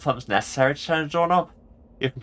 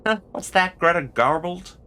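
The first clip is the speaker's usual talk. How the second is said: higher-pitched attempt at Scottish slipping into American; wobbly Scottish gone American; accidental American accent; 7 semitones higher than usual. Player voices a game character, accent slipping from Scottish into American. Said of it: "now the American is happening".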